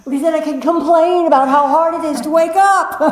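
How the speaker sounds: the ego's self-pitying complaint, hammed up; whiny ego. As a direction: play the ego, whining about waking up. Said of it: "The ego says".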